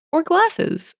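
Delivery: high-pitched, helpful clerk explanation; patient explaining. Acted.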